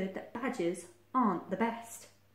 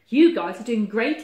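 loud, rousing praise; cheered; 13 dB louder than usual. A narrator's reading. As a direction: cheer them on at full voice. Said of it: "he cheered".

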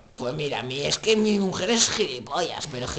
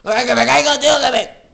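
yelling as he falls; panicked fall scream; screaming while falling; 6 semitones higher, 7 dB louder than usual. Pretend.

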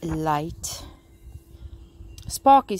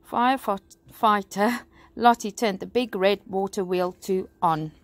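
ordinary delivery, nothing marked

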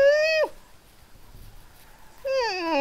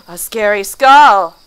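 1 gasp of surprise; 11 dB louder, slower than usual. Real, not acted. Gasps at skull in game.

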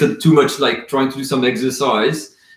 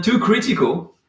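surprised question; 6 semitones higher than usual. Real, not acted.

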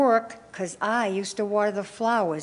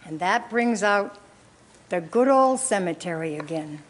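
ordinary talk, nothing marked

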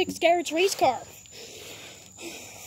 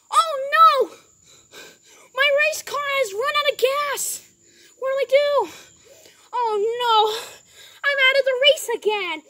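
higher, louder cries of dismay; upset outcry; 5 semitones higher, 5 dB louder than usual. Pretend. Driver out of gas cries out, high and loud. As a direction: wail it like the race is ruined.

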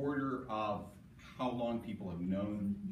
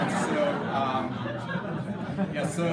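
laughing; 6 semitones higher, 8 dB louder, slower than usual. Real, not acted. Still laughing after the joke.

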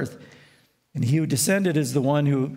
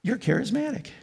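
teasing; 8 semitones higher than usual. Retold or imitated. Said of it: "stir up evangelicals by saying".

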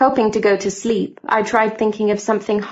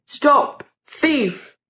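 higher, raised shout of alarm; 5 semitones higher than usual. A narrator's reading. The servant chases the intruder downstairs, shouting. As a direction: call out after the thief. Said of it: "and he was shouting".

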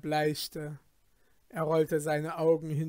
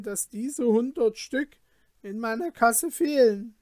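higher, anxious merchant's voice; 7 semitones higher, 7 dB louder than usual. Acted. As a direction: plead his case nervously to the caliph. Kalum pleads before the caliph.

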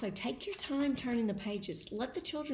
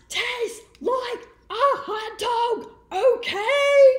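high, loud exasperated yell; frustrated pigeon shouting; 13 semitones higher, 10 dB louder, slower than usual. Acted. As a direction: lose patience completely.

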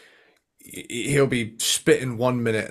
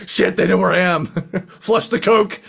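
mock panic; 5 semitones higher, 4 dB louder than usual. Joking panic about being found.

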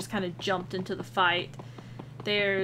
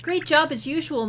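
higher, louder bright praise; cheerful; 7 semitones higher, 6 dB louder than usual. Pretend. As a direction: cheer him on. Character cheering Mario.

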